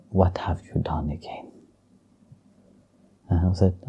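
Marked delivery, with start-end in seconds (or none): 0.1-1.5 soft whisper, half-scolding; a hushed, gentle scolding whisper; whispered reproach. Retold or imitated. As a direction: whisper it like a worried rebuke. Bhakti Devi questions Lord Krishna.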